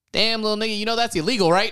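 a driver teasing from his window; imitating tow truck driver. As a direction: play the amused tow truck guy. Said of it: "rolls down his window and goes".

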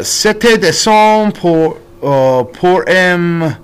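slow, clumsy French with an American accent; foreign accent in french. Real, not acted. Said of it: "try my absolute best to try to speak French".

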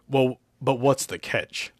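slow, wary question; slow and hesitant; asked slowly. Acted. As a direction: draw the question out cautiously. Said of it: "I asked slowly".

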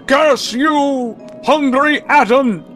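high, hammed-up outcry; theatrical cursing. Hero damns the cad who took his companion, melodramatic outrage. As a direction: curse the villain with grand outrage.

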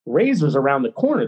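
normal talking voice

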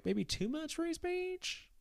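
slow squeaky sarcasm; high sarcastic question. Acted. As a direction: drag it out in a high tease.

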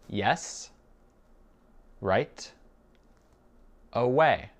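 very slow, long gaps between words; spaced-out language-drill reply. Shown as a demonstration. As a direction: give each word separately for a learner. Teacher repeats it word by word.

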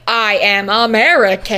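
a hint of a stiff fake American accent; a British actor forcing an American accent; bad American accent. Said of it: "the accent in this is atrocious".

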